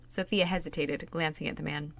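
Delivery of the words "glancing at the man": ordinary delivery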